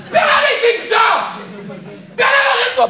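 very high, hammed-up furious shout; enraged yelling. Shown as a demonstration. Parent venting all their rage on a misbehaving child, high furious yelling. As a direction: scream the orders at the child. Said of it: "extérioriser toute la rage que t'as en toi".

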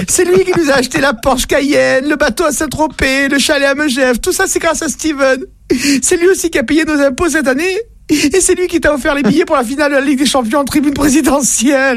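high pleading wife's voice, frantic; panicked wife. Acted. Wife caught in bed begging husband not to shoot, high and frantic. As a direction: beg him not to shoot in a high squeal. Wife lists lover's gifts.

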